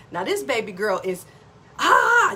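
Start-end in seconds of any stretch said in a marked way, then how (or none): 1.8-2.3 high, loud mock scream of panic; loud, high-pitched imitation of freaking out; freaked-out cry. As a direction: imitate a daughter losing it with a sharp "ah". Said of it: "literally freaking out".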